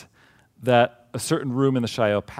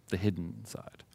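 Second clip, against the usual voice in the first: whispered; 2 semitones lower, 10 dB quieter than usual. Real, not acted. Dramatic reveal at end of phrase.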